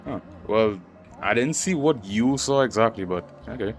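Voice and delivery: normal talking voice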